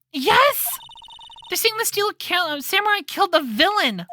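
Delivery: frantic Maya. Acted. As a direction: rush the news out. Maya panicking.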